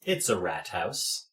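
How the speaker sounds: slow, soft chorus of rats; communal rat voice. Acted. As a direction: speak as one low hive voice.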